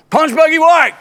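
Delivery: loud, high mocking call-out; hammed-up shout of an odd friend; mock New Hampshire friend. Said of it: "they're gonna be like".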